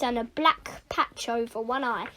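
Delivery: usual speaking voice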